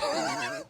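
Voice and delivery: helpless high laughter; high wheezy laugh. Real, not acted. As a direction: crack up at the memory. Cracks up at friend's injury.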